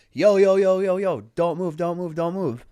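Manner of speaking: high, loud retold yell for them to stop; urgent calling out. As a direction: re-enact a frantic shout to halt. Retelling yelling from under truck.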